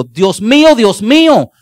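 loud, slow, pleading cry to God; anguished psalm cry. Acted. Preacher voicing King David crying out to God, slow and loud. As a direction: cry out slowly, as if abandoned. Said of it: "David escribió".

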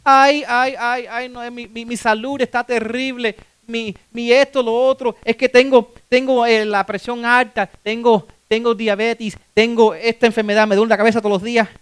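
put-on "poor me" griping; mock complaining. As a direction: whine through it like a chronic complainer. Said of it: "Estamos quejándonos".

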